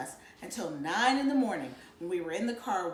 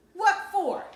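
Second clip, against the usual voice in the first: put-on surly boy; 9 semitones higher, 7 dB louder than usual. A narrator's reading. Byron protests.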